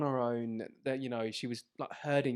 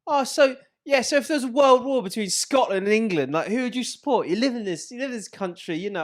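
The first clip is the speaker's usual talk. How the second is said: higher, louder put-on girlfriend voice; girlfriend's accent; 10 semitones higher, 10 dB louder than usual. Girlfriend arguing about loyalty to England, mocking accent. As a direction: lecture him in a mock accent. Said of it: "she was like".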